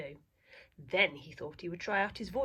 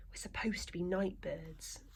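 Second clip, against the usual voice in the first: whisper; 7 dB quieter than usual. Acted. Owl confesses fear.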